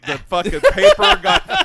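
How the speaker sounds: high, loud laughing burst. Real, not acted. Retelling the paper boy story, laughing hard. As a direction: crack up mid-sentence.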